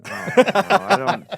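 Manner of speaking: high, loud laughing "oh no"; horrified giggle at the idea; laughing mock dread. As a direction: squeal it out through a laugh. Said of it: "and I'm thinking".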